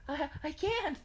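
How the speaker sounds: high, loud stammered cry; overwhelmed, crying out; panicked protest. Acted. Panicked character answers soothing voice.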